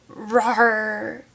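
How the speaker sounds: drawn-out mock roar; imitated big-cat growl; playful tiger roar. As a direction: roar like a child playing tiger.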